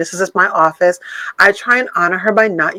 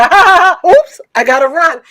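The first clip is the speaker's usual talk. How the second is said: mock laugh; 8 semitones higher, 4 dB louder than usual. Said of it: "you start doing that big laughter".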